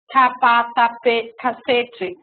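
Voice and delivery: "capatape-, cacete" com sílabas intrusas, bem devagar; intrusão de sílabas. Shown as a demonstration. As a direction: tropece inserindo sílabas a mais em "capacete". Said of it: "se ela introduz um monte de sílaba". (imitando a criança com intrusão de sílabas)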